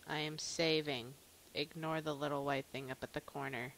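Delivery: normal talking voice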